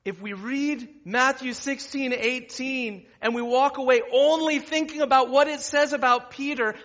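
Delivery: loud, high, driving sermon delivery; full-force preaching. Real, not acted. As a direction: preach it out to the whole room.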